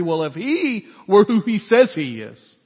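building from firm to a high, loud outraged "She's a sinner"; indignant outburst. Shown as a demonstration. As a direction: let the boil rise into a shout. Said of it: "You can almost hear the internal dialogue of this Pharisee".

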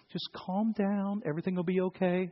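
soft, soothing reassurance; comforting. Acted. Imagined Jesus calming the storm nicely, comforting. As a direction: hush and reassure gently.

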